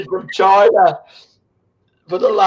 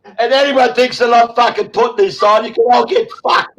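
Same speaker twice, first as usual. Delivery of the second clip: usual speaking voice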